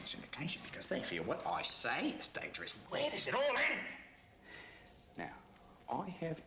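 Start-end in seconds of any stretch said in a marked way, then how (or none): 2.9-3.8 very high, loud rhetorical cry; theatrical exasperated yell; impassioned outcry. Acted. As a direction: hurl the question out at full force. Climax of reporter's rant.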